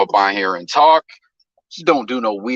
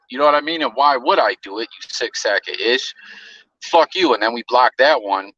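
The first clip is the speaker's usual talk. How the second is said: ordinary talk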